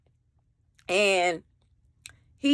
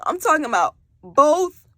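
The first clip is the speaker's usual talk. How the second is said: normal talking voice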